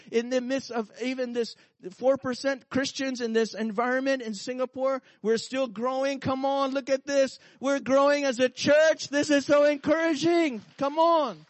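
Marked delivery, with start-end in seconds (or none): none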